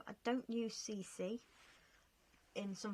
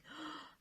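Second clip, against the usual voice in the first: sudden gasp; 8 semitones higher, 7 dB quieter than usual. Real, not acted. Realises she forgot fairy lights.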